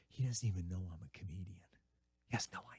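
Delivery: soft, breathy aside; whispered aside. Real, not acted. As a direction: let the audience in on the secret. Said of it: "aside whispered to audience".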